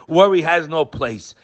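ordinary talk

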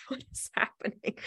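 hushed, voiceless aside with a laugh before it; bewildered whisper. Retold or imitated. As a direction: whisper it to yourself in disbelief. Bewildered aside from the back seat.

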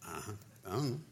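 sheepish mumble. Retold or imitated. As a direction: mumble it like you have no answer. Sheepish self in retold questioning.

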